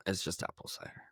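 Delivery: whispered confession; breathy aside, almost no voice; whispering. Real, not acted. Joking aside about her drink.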